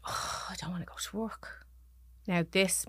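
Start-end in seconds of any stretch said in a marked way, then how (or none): 0.1-1.7 soft, airy retold groan of dread; reluctant sighing. Husband confiding he dreads work, soft and sighing. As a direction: imitate a weary, hushed reluctance. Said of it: "put his hand on his chest and he went".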